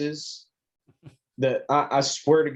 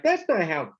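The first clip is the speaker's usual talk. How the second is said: imitating the wife; 6 semitones higher than usual. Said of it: "She's like".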